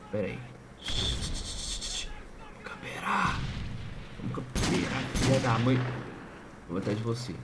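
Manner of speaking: hushed, scheming whisper; sneaky whisper. Real, not acted. Gamer sneaking into a camping spot, whispering slyly. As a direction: whisper it like you're hiding in ambush.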